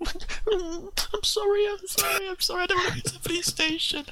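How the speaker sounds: high whimpering apology; crying child. Retold or imitated. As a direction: beg through mock tears like a small boy. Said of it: "It's absolutely crying".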